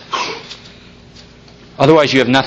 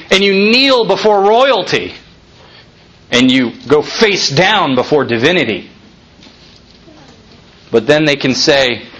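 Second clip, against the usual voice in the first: ordinary talk, nothing marked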